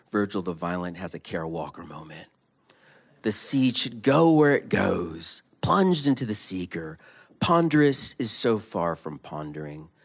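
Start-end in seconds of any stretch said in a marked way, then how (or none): 3.2-7.0 emphatic reading. A narrator's reading. Reading dramatic lines loudly.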